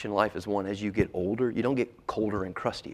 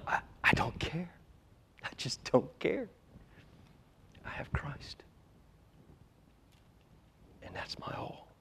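soft, breath-heavy near-whisper; hushed conviction; 6 semitones higher, 8 dB quieter than usual. Acted. Preacher answering life's troubles with Christ alone, hushed conviction. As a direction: drop to a hush and mean it.

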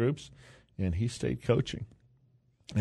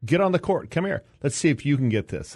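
higher, raised imitation of a son yelling; retold yell; 8 semitones higher, 4 dB louder than usual. Son yells at dad mid-tournament, retold yell. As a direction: mimic an exasperated shout across the court. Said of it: "and he yells".

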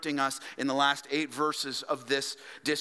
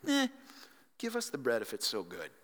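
dismissive sarcasm; 4 semitones lower, 5 dB quieter, faster than usual. Retold or imitated. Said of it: "That's sarcastically".

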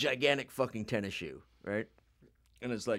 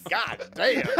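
mock awe; 9 semitones higher, 6 dB louder than usual. Imitating Flea sniffing the shoe.

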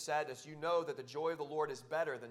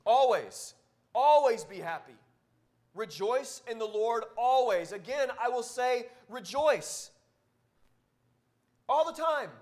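booming pulpit exhortation, rising in force; loud proclaiming; 6 semitones higher, 10 dB louder than usual. Shown as a demonstration. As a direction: preach it out to the back pews. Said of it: "in case you didn't hear me in the back".

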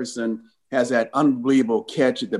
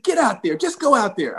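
impatient order; 10 semitones higher than usual. Retold or imitated. Coach sending a worried player back onto field, impatient. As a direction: imitate a coach shooing a player away.

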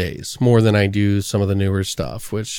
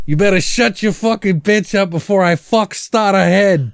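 quoting movie thug; 8 semitones higher than usual. Retold or imitated. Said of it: "He's like".

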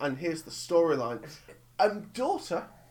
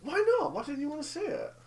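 high-pitched disbelieving question; incredulous; 8 semitones higher than usual. Real, not acted. Friend questioning why he skipped Eight Mile, incredulous. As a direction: ask it in high, baffled disbelief.

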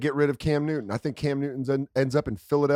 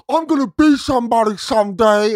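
higher, louder mock dramatic declaration; mock biopic line; 9 semitones higher, 6 dB louder than usual. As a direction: ham up a cheesy movie line. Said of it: "he's like".

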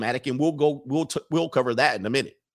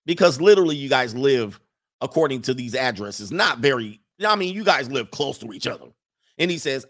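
ordinary talk

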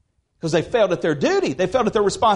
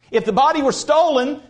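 ordinary talk, nothing marked

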